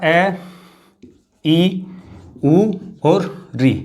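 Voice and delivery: ए, ई, उ और री drawn out one by one, री stretched very slowly; vowels listed slowly. Shown as a demonstration. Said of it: "मूल रूप से स्वर होते हैं". (मात्राओं को धीरे-धीरे गिनाना)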